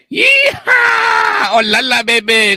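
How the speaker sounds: shrieked yee-haw easing into a slow, high call; playful cowboy whoop. Pretend. Preferring horse play to cows, high playful whoop. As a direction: whoop it up in mock rodeo style.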